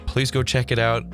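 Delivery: ordinary talk, nothing marked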